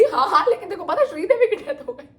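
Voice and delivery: high, laughing imitation of mom. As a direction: mimic mom's eager gossip through laughter. (हँसते हुए mummy की नकल)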